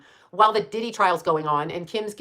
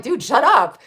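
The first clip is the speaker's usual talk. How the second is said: retorting shout; 7 semitones higher, 8 dB louder, slower than usual. Retold or imitated. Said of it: "I'm like".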